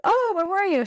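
high-pitched imitation of a curious greeting; mock excited greeting. People at the bar greeting her, excited. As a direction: voice a friend greeting someone high and keen.

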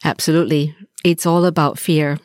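ordinary delivery

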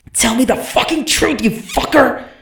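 high, full-throat furious scream. A narrator's reading. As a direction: scream it with all your rage. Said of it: "Shalot screamed".

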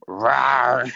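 loud high silly rawr; lion roar. Pretend. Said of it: "A really dumb lion".

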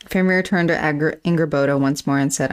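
ordinary talk

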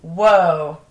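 usual speaking voice

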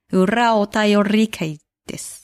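裏お便り会です slow with the r trilled on ら and り; rolled r. Shown as a demonstration. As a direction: roll ら and り in 裏お便り会です slowly. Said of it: "リ、リもね".

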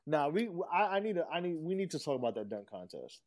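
usual speaking voice

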